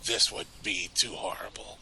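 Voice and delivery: a first attempt at a sinister hushed voice; Dorn. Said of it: "can you read it in Dorn's voice?".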